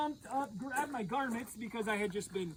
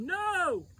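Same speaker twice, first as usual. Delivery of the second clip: louder, higher shouted no; yell; 5 semitones higher, 6 dB louder than usual. Retold or imitated. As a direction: yell it back to the shore. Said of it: "we yelled".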